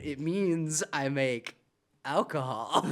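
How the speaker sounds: slow, with a dramatic pause; imitated bartender deadpan. Bartender answering puzzled tourists, slow deadpan. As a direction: explain nothing, slowly and evenly. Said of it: "he goes, it's like".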